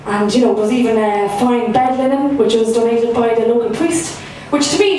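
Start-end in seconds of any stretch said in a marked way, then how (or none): none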